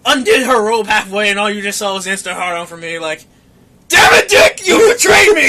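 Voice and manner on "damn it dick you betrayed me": high, loud cry of betrayal; mock anguished shout. Man scolding his own body for betraying him, mock anguished shout. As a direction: wail it out in hammed-up outrage.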